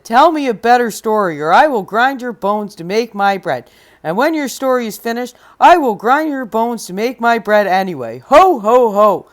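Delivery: loud, threatening giant; menacing giant. Acted. Giant squeezing Jack and threatening him, loud menace. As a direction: loom over him and gloat. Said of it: "The giant laughed an ugly laugh".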